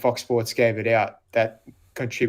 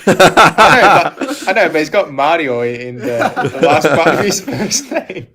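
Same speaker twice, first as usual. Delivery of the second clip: laughing; 5 semitones higher, 12 dB louder than usual. Real, not acted. Laughing while explaining the accent.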